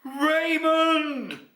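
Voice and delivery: high, drawn-out calling shout; big ringing call; loud shout. A narrator's reading. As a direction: holler a child's name joyfully. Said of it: "shouted out loudly".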